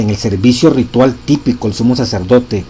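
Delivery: loud, solemn reading of a sacred text; preacher reading scripture with force; reading a quotation aloud. Acted.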